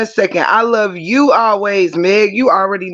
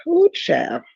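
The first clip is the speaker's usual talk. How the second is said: soft high sassy exclamation; 11 semitones higher, 4 dB quieter than usual. Real, not acted. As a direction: sigh it up high. Exclamation repeated.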